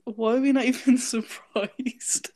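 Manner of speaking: words broken by a laugh. Real, not acted. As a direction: laugh through the question. Reacting to funny voice note.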